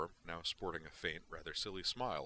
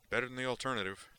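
big, wired, careless delivery; Duke's voice; 6 semitones higher, 6 dB louder, faster than usual. Acted. Duke brushes off Zoner's question, loud and wired.

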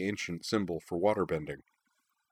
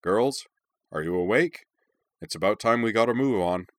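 raised, muffled voice from outside the door; muffled through a door; 3 semitones higher, 4 dB louder than usual. A narrator's reading. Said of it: "Nanaruk's muffled voice inquiring".

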